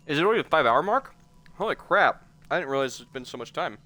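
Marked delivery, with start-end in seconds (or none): 0.1-2.2 surprised. Real, not acted. Surprised by the time.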